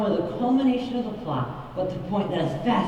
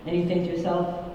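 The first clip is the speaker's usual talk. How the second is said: usual speaking voice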